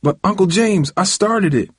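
put-on high-pitched boyish objection; pleading protest. A narrator's reading. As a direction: plead your case to an angry uncle. Boy protests to his angry uncle.

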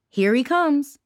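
plain reading voice

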